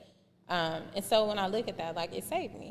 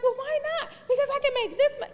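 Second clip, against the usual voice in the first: much higher and louder, pleading; pleading teen self; 8 dB louder, much faster than usual. Retold or imitated. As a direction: whine it like a teen arguing with dad. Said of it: "I was just like".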